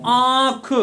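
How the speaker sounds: आंख drawn out, the आ sounded through nose and mouth; nose-and-mouth vowel. Shown as a demonstration. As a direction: stretch the nasalised आ in आंख, then release ख. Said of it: "नाक और मुंह". (आंख का आ नाक और मुंह से खींचकर)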